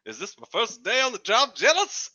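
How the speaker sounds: put-on rising, high old-time actor questions; thirties actor. Rookie on first day at the job, thirties actor voice. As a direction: fret like a thirties movie rookie. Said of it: "I'm like a thirties actor".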